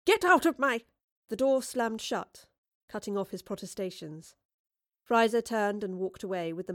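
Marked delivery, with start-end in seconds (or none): 0.0-0.8 high, loud yell, cut off; yelled protest. Acted. Woodliffe to thugs in his house, yelling. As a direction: yell at the intruders until cut short.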